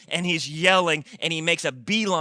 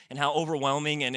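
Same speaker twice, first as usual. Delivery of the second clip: usual speaking voice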